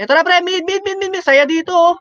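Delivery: high, urgent rallying call to mid; excited callout. Real, not acted. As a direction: pitch jumps up as the fight starts. Team fight breaking out in mid.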